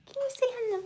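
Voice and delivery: put-on whispered high baby talk to a kitten. Shown as a demonstration. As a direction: breathe it softly to the kitten.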